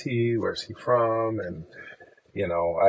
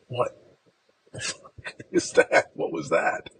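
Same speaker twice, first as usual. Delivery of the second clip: laughing disbelief; 3 semitones higher than usual. Real, not acted. Laughing disbelief after the fight.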